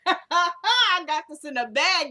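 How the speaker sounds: alto, forte e lento, tutto sicuro di sé; a cocky guy who knows he has won, said high, loud and slow; smug David. Retold or imitated. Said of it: "So David looking like".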